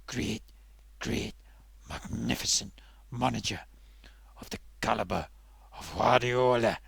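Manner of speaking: hushed, breathy awe at a dream manager; reverent whisper. Acted. Puppet whispering in awe of a great manager. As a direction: whisper it like a prayer.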